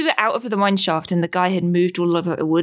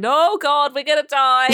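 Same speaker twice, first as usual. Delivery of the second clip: high-pitched comic dread; mock panic; 7 semitones higher than usual. Dreamer retelling the timber shifting, mock panic. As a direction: wail in mock alarm, breaking into laughter. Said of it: "and it was like".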